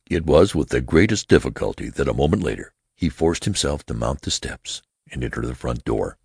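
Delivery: ordinary delivery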